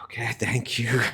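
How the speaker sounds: chuckling through an imitated thank-you; a wry, amused thanks with a laugh; laughing mock thanks. Indra mock-thanking the sage.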